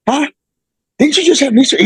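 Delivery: high, incredulous mock double-take; imitating a surprised coach. Coach at the weigh-in sees the surgery knee, surprised. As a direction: play the coach's stunned disbelief.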